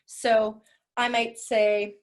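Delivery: normal talking voice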